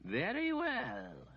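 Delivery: a lightly voiced, patient old wizard; the wizard calmly answering the demanding lady. A narrator's reading. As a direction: humour her politely and slowly. Wizard concedes.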